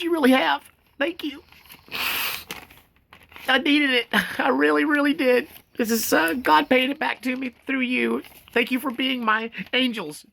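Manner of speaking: real tears, voice cracking up high; pushing the thanks out through crying; choked up. Real, not acted. Thanking viewers as his angels, tearfully choked. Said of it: "it's choking me up".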